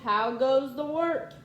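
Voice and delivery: sleepy farmer shouting a demand; farmer calling out. Acted. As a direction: call it out to the yard. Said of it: "and he called".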